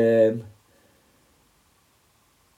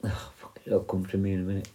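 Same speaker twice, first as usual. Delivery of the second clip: whispered curse under the breath; hushed, annoyed with himself; a quick voiceless curse; muttered swear; 4 semitones lower, 12 dB quieter than usual. Real, not acted. Forgets name mid story.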